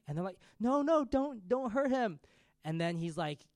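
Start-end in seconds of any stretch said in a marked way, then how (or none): 0.6-2.2 high, loud, hammed-up pleading; imitated crying plea. Women beg the Vikings for a man, mock-crying plea. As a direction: plead in mock panic, pitched way up. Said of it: "like, crying".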